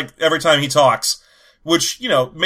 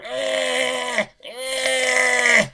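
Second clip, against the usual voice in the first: high strained Wookiee whine; high whiny grunts; 8 semitones higher, 3 dB quieter than usual. Retold or imitated. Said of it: "that horrible noise he's making".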